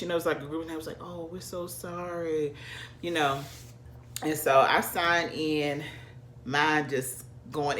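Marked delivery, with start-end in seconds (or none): 1.0-2.5 hushed, slow, much softer apology; soft sympathy. Retold or imitated. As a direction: say it quietly like consoling a stranger. Group consoling her.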